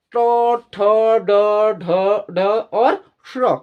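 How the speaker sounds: ट, ठ, ड, ढ, श्र one by one, tongue curled back; retroflex sound drill. Shown as a demonstration. Teacher drilling pupils on the sha made like ट, retroflex drill. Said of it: "ऐसे ही बोलोगे".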